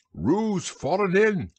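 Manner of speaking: high, drawn-out call of alarm; crying out. A narrator's reading. Rabbit alerts the others to Roo's fall, crying out. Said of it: "cried Rabbit".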